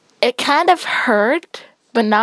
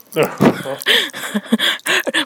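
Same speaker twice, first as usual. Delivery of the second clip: giggling; 5 semitones higher than usual. Real, not acted. Child reacts to popping candy.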